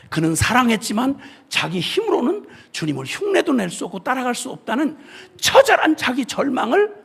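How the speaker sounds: ordinary talk, nothing marked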